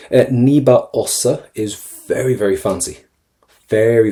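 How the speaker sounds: ordinary talk, nothing marked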